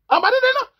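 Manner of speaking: high loud scornful no; mock refusal. Woman rejecting the Cameroonian guy, high mock squeal. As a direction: squeal a dismissive no.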